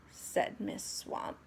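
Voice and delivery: plain reading voice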